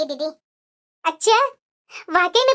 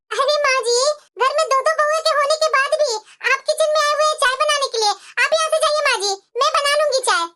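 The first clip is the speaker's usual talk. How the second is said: sweetly fussing; 4 semitones higher than usual. Acted. Daughter-in-law fussing over mother-in-law.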